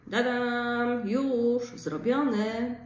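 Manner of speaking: put-on playful "ta-da" for a child; cheerful announcement. Shown as a demonstration. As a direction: announce it like a little triumph. Adult voicing a finished action.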